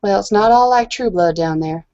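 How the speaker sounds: ordinary delivery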